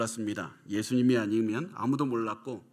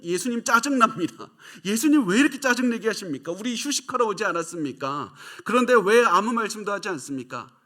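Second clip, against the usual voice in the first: higher and louder, irritated complaining; annoyed disciples; 7 semitones higher, 5 dB louder than usual. Acted. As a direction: whine at Jesus like fed-up disciples. (짜증난 제자들처럼 높고 크게 투덜대며)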